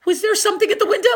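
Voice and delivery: high, loud and rushed; scared child at night; frightened. A narrator's reading. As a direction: blurt it in fear. Frightened fast.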